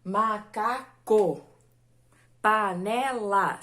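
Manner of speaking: macaco, panela said slowly, syllable by syllable; slow syllable demo. Shown as a demonstration. Teacher to class, slowly spelling out syllables. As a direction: stretch macaco and panela out, beat by beat. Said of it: "Palavras com três sílabas".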